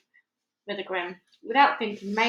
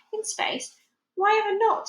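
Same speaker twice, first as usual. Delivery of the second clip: demanding; 12 semitones higher than usual. Acted. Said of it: "demanded Ruth".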